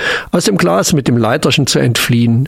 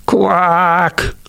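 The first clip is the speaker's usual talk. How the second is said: a long, higher frog's cry in the rain; drawn-out croak; 5 semitones higher than usual. A narrator's reading. Frog croaking out its disappointment, long and high. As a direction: stretch the Quack into a wail. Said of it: "langgezogen rief".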